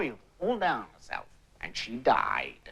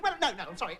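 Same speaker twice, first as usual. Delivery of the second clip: normal talking voice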